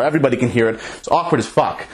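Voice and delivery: ordinary talk, nothing marked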